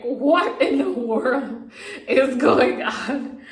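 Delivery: slow, loud, laughing disbelief; half-laughing what-on-earth; stunned disbelief. Retold or imitated. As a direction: drag out the shock with a laugh. Shocked at her swollen face.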